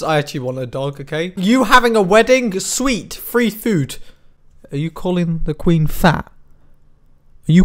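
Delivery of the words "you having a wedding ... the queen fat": ordinary delivery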